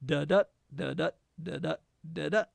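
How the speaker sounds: da-DA, da-DA, each pair light then stressed; iambic rhythm. Shown as a demonstration. Teacher showing students the iamb, stressing each second syllable. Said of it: "unaccented syllable followed by an accented one".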